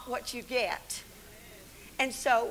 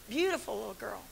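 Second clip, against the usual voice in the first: ordinary delivery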